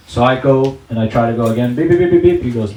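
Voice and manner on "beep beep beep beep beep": quick, loud imitated beeps; metal detector beeping. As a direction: mimic the detector's alarm. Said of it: "there's a metal detector".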